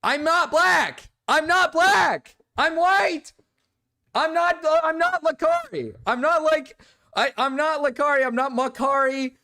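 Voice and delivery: loud flustered denial to chat; indignant loud denial. Real, not acted. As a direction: insist loudly, drawing the words out in disbelief. Denying sounding like Lakari.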